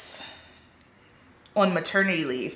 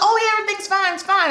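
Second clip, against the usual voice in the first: high, chirpy forced reassurance; flustered client; 13 semitones higher, faster than usual. Retold or imitated. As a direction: brush it off in a high put-on voice. Shocked client covering awkwardly.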